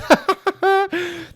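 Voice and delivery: short high laugh at his own joke; burst of laughter. Real, not acted. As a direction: let a real laugh break out.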